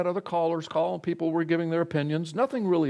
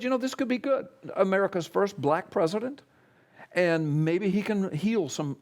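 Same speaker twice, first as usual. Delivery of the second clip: retold positivity; 6 semitones higher than usual. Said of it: "I mean, Mister Positivity".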